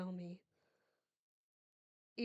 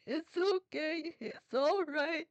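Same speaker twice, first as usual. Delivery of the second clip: slower, higher, breaking reassurance; strained soothing; 7 semitones higher, 4 dB louder, slower than usual. Acted. Mother soothing her daughter while barely holding on. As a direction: hold it together as the voice cracks.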